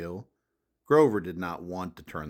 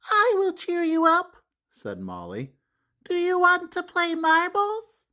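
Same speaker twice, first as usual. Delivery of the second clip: put-on cheerful little girl; 5 dB louder than usual. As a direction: coax a sad friend into playing. Molly voiced, cheering Grover up.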